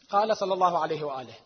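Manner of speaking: normal talking voice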